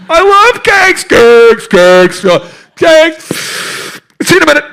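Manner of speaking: loud, high, hammed-up love of cake; gushing over cake. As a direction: shout your love for cakes.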